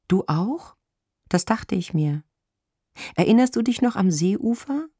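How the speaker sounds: high-pitched, questioning woman; woman caller. A narrator's reading. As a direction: ask a long-lost lover eagerly.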